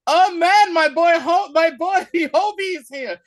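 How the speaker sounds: high, loud mock-thrilled welcome; hammed-up fanboy excitement, breaking into a laugh; excited greeting. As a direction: hail your buddy at full pitch. Imitates thrilled greeting of a friend.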